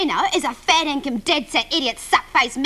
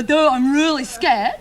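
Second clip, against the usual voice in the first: slow, drawn-out mock fear; sarcastic; slower than usual. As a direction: drag it out to dismiss the threat. Mocking reply to a threat.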